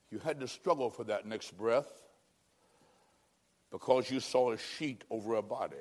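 low, soft, sombre telling; hushed gravity. Real, not acted. Preacher recalling a body under a sheet, hushed and grave. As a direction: let it sink in, quiet and low.

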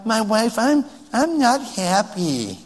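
slow, put-on moping complaint; mock unhappy husband. Husband complaining to his wife, mock pout. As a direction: pout it slowly like a sulking husband. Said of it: "Men don't talk like that".

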